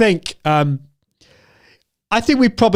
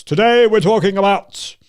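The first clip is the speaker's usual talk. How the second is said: loud, raised announcement with heavy gravity; hammed-up serious presenter; mock serious presenting; 4 semitones higher, 5 dB louder than usual. Shown as a demonstration. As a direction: overdo a stern show opening. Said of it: "if I was speaking like this".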